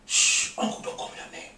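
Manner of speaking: urgent shushing, all in whisper; hushed whisper. Acted. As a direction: whisper it like a secret warning. Young man hushing his uncle.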